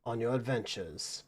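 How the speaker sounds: a lightly voiced host bidding champions farewell; courteous host's farewell.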